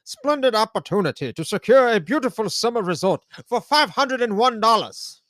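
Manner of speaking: high, loud 1880s pitchman voice; old-timey announcer. Shown as a demonstration. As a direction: sell it like an old-timey huckster. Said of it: "It would probably would have sounded more something like".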